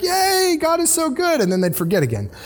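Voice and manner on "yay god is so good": high, bright-eyed cheer of relief; mock cheer. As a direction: gush it like the rescued crowd. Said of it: "they'd be like".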